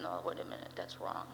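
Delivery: whispered self-correction. Real, not acted. Corrects himself aside.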